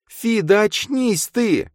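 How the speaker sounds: put-on very high, drawn-out girl's cry; exclaiming girl character. A narrator's reading. Girl tells her friend to wake up, exclaiming. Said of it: "воскликнула она".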